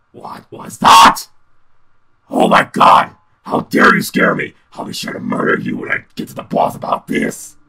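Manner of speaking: loud panicked yelling; startled shouting. Real, not acted. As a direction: shout it, shaken by the scare. Reacting to jump scare.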